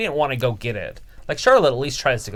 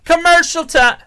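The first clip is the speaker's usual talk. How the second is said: announcer shout; 12 dB louder than usual. Acted. Announcing the commercial break.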